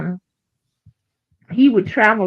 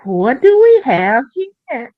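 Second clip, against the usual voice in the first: high, slow curious voice; high, slow teasing question; mock suggestive; 6 semitones higher, 5 dB louder, slower than usual. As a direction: ask with sly interest. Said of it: "the man was like".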